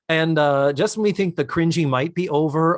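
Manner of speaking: usual speaking voice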